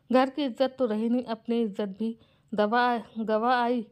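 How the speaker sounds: ordinary delivery, nothing marked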